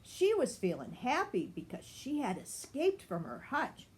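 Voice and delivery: plain reading voice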